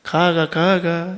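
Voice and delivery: 가가 가가 said slowly, Gyeongsang pitch pattern; Gyeongsang dialect. Shown as a demonstration. Pastor demonstrates Gyeongsang dialect to congregation, slow Gyeongsang 가가. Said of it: "경상도 말도 마찬가지겠죠".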